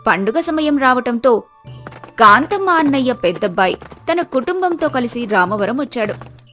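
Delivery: usual speaking voice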